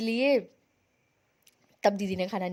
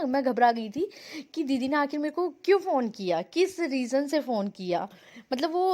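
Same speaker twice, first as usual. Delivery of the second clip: ordinary talk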